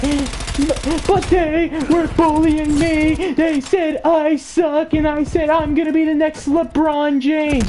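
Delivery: put-on high, drawn-out whiny kid voice; mock freak-out. Kid complains to cop of bullying, mock freak-out. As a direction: whine it like a hammed-up tattling child. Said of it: "freaks out".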